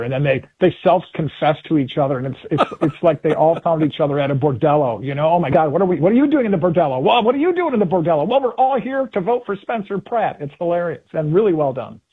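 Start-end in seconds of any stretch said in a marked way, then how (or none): 5.3-10.4 high, loud, hammed-up shock; mock astonishment. Voters bumping into each other at a bordello, mock-scandalized. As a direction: play flustered acquaintances caught out, pitched well up.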